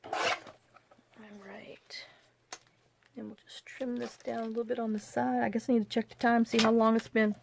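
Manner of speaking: hushed, half-whispered mutter; quiet aside. Real, not acted. Crafter talking to herself while trimming, soft whisper.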